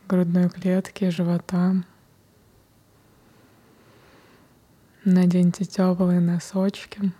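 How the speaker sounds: whispered meditation guidance; guided meditation. Acted. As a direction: guide them gently into the breath. Leads a breathing meditation.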